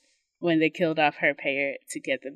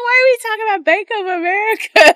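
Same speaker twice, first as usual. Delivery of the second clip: high, loud, laughing disbelief; retold question exploding into a high-pitched cry; incredulous outburst; 12 dB louder, faster than usual. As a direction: blurt it fast, loud and high through laughter. Retold outburst over a silly answer.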